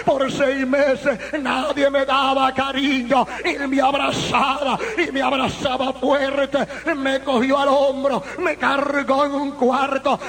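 forceful testimony sermon voice; impassioned preaching. Real, not acted. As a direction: preach the story with full intensity. (predicación apasionada a toda fuerza)

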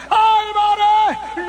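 high, drawn-out cry of a name; imitated shout. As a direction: shout the name slowly, pitched well up. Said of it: "de repente él me grita". (grito agudo y alargado)

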